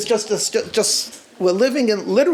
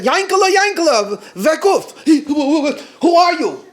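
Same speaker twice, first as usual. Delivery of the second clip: llamada fuerte y aguda; despiértalo gritando su nombre; calling out; 8 semitones higher, 6 dB louder than usual. Retold or imitated. Waking the man at night.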